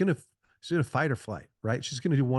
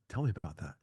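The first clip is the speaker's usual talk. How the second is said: soft, lower, caring ask; gentle invitation; 6 semitones lower, 7 dB quieter, much faster than usual. Shown as a demonstration. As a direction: softly invite her to share. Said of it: "Instead of going".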